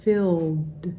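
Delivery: "filled" ending on a crisp audible D; clear final D. Shown as a demonstration. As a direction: land the D at the end of "filled". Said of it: "we want to hear that D".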